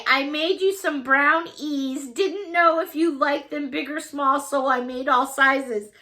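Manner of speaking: ordinary talk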